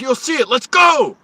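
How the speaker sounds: high, loud impatient yell; yelled order. Retold or imitated. Security guard yelling at the driver to hurry, loud. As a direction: shout him along, fed up. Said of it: "He yelled at me".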